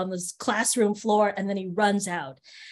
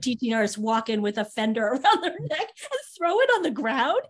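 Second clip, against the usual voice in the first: high, incredulous protest through laughter; real amused outrage, voice riding up high; laughing disbelief; 7 semitones higher, 3 dB louder than usual. Real, not acted. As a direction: exclaim it while laughing, pitch climbing way up.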